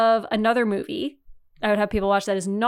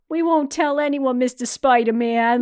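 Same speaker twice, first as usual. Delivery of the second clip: New Yorker voice; 5 semitones higher, 7 dB louder than usual. Retold or imitated. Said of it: "and they're like".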